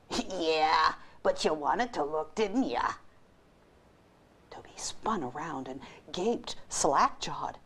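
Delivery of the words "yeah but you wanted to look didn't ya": gruff dog voice. Acted. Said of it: "he heard a gruff voice say".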